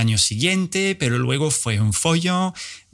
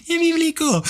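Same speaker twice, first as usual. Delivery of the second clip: much higher, mock-awed echo; squeaky repeat. Shown as a demonstration. Repeating his own joke about the letters, very high squeak. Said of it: "eso es bíblico".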